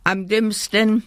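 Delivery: a narrator's understated shout; mild yell. A narrator's reading. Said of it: "schrie der Teddy".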